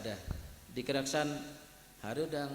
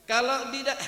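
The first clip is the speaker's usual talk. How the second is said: ordinary delivery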